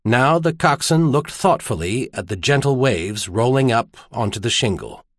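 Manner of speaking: ordinary delivery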